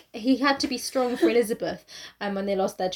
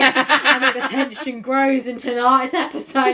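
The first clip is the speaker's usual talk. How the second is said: trailer voiceover; 6 dB louder, slower than usual. Pretend.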